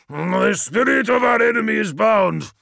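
high, loud angry snarl; snarled shout. A narrator's reading. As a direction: spit it out in rage. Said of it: "snarled Biaki".

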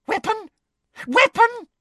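very high, incredulous squeal; astonished high-pitched query; excited. A narrator's reading. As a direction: repeat it with mounting force. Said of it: "eyes seemed to pop with excitement".

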